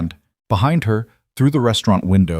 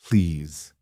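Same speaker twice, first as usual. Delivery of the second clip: soft, lower, gentle plea; 4 semitones lower, 5 dB quieter than usual. A narrator's reading. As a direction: ask kindly, almost tender. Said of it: "Emiko said gently".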